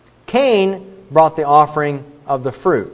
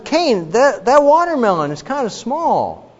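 higher-pitched, lightly voiced fault-finding; mildly acted critical God; 8 semitones higher than usual. God inspecting Cain's fruit offering, mildly critical. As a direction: gently point out a flaw, pitched up.

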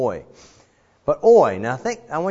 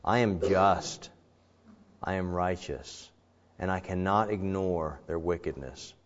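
softer, slow regretful voice; sorrowful God; 4 semitones lower, 6 dB quieter than usual. Acted. God grieving over the wicked, soft sorrow. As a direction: speak it with heavy regret. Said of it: "He says".

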